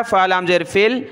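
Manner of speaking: ordinary talk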